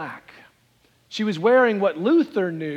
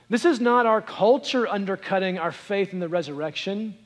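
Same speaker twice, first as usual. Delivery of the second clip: ordinary talk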